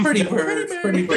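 lightly voiced high parrot squawk. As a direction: chirp it like a talking parrot. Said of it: "Can you say pretty bird?".

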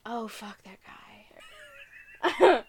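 slow whispered curse; disgusted whisper. Real, not acted. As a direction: hiss it under your breath in disgust. Disgust on hearing Trump.

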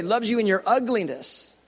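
ordinary talk

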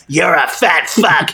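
slow, drawn-out mock taunt; mock taunting chin. Host voices his double chin insulting him, mock taunting. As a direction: let the insult stretch out slowly. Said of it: "reminded me".

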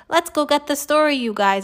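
mild shout, starting high; rally the group with a light shout; Alexia shouting. A narrator's reading. Girl urging her reporter friends on, shouting mildly. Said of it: "Alexia shouted".

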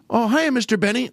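put-on high boyish tenor greeting. Radio boy tenor greets his boss, high and boyish. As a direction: chirp it like an eager radio boy. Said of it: "[name] would always come on".

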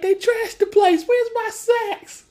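high, loud wail, stretched at the end; mock distraught cry. Steve sees his place trashed, mock distraught cry. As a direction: cry it out high in exaggerated dismay. Acting out Steve's panic at robbery.

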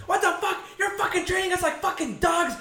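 high-pitched mock yell at the guy; retold screaming. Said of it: "Screaming at the guy".

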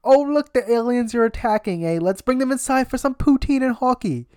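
a cheerful mock Canadian accent, eh. Canadians welcoming the aliens, mock Canadian accent. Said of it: "They'd be like".

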